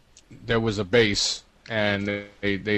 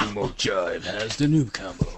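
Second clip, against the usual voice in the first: slow announcer voice at usual pitch. Pretend. Game announcer tells players who has the combo, slow announcement. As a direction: announce it slowly, in a put-on voice. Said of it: "So you want an announcement".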